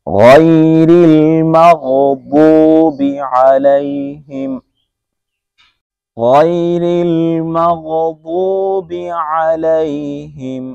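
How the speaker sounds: slow, melodic tajweed recitation; Quran recitation. Shown as a demonstration. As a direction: draw out ওয়ালাদ-দাল্লিন slowly and melodically. Recites closing verse.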